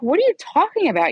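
high, quick incredulous retort; acted-out exasperation, pitch jumping up; retold disbelief. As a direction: fire back in exasperated disbelief. Retells arguing with him in disbelief.

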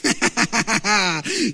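loud burst of ha-ha laughter; real laugh. Real, not acted. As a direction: laugh hard at your own punchline. Laughs at his own punchline.

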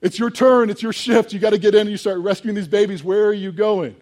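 high, loud, panicked villager outburst; freaking out. Acted. Villagers calling after the man walking upstream, freaking out. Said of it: "everybody starts kind of freaking out".

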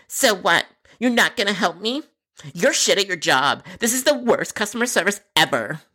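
high-pitched, indignant caller; put-on outraged customer voice; angry customer.

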